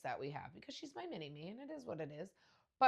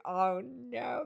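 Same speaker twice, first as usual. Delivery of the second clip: dismayed oh no; 7 semitones higher, 8 dB louder than usual. Retold or imitated. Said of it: "I was like".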